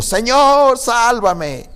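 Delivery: high, loud plea for rescue; crying out. A narrator's reading. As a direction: call out for help, stretching the first word. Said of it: "dio voces diciendo". (clamor agudo pidiendo auxilio)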